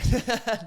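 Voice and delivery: a burst of high, loud laughter at his own joke. Real, not acted. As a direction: break into a real laugh.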